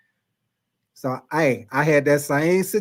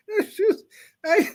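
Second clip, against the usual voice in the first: high wheezing laugh through tears; crying laughing; slower than usual. Real, not acted. Cracking up over the tiny sister, high crying laughter. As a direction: laugh till it hurts. Can't stop laughing telling story.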